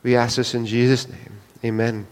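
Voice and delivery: low, soft prayer voice; quietly closing the prayer. Acted. Closing prayer before sermon.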